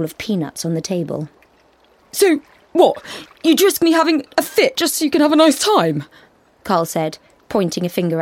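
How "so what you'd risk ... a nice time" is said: high, louder indignant accusation; accusing. A narrator's reading. Boyfriend accusing girlfriend of selfishness, indignant. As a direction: turn it back on her, wounded.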